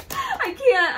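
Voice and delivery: high frightened cry; scared squeal. Real, not acted. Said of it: "I'm so scared".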